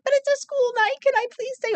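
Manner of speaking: put-on high little-girl whine; five-year-old begging her dad, high and pleading; pleading child. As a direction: beg like a small child who wants to stay home.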